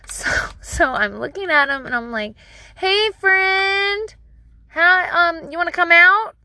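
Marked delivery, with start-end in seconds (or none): 2.8-6.3 high, loud singsong coaxing; falsely breezy, high parent voice; coaxing a toddler. Retold or imitated. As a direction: coax a small child out, pitched way up. Voiced coaxing of toddler under rack.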